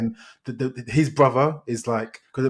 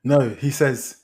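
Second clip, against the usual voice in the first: ordinary delivery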